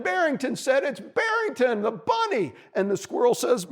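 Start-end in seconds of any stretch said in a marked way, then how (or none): 0.8-2.5 higher-pitched bunny voice; calling up. A narrator's reading. Bunny introduces himself to squirrel, calling up. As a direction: call your name up to the treetop. Bunny calls up his name.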